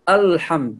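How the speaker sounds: الحمد with a thick, heavy opening hamza and lam; heavy mispronunciation. Shown as a demonstration. Teacher shows learners the common error, heavily pronounced الحمد. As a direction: say the "al" of الحمد dark and heavy, as a mistake. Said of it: "وهذا خطأ".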